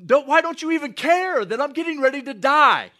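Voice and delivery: high, loud frantic yell, a bit mock; panicked yelling. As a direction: shake him awake with a frantic shout. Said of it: "They're yelling at Jesus".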